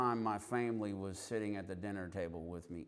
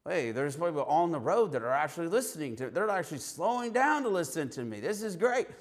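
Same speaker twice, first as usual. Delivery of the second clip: growing excitement; 6 semitones higher, 4 dB louder than usual. Retold or imitated. Thrilled people are listening.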